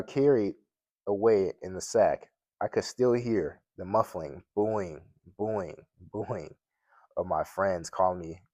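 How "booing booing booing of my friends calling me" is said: usual speaking voice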